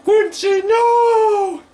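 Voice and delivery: very high, loud cry of alarm; shout. Real, not acted. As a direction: yell out in dismay. Teammate dies in game.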